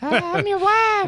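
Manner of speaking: very high, slow falsetto wife voice; hammed-up high wife; screechy demon wife. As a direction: play a shrill caricature of a bride.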